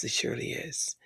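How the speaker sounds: a fond whisper to himself. Real, not acted. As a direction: whisper it, with no voice behind it. Whispered repeat.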